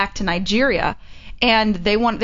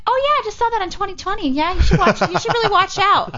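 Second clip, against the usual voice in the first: friends' mock reply; 10 semitones higher than usual. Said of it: "And they're like".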